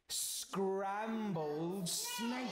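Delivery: "scrambled snake" stretched out very slowly; menacing relish. Acted. Mouse threatens the snake.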